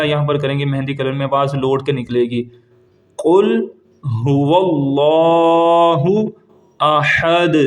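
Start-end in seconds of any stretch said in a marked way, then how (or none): 3.2-7.6 very slow, drawn-out tajweed chant; slow devotional recitation; Quranic recitation. Shown as a demonstration. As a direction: recite each word stretched and melodic. Recites corrected verse.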